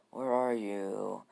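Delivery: slow, drawn-out eerie search; Gaara. Acted. Kid searches again for vanished Naruto, slow and eerie. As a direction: stretch each word out creepily. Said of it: "Gaara's like".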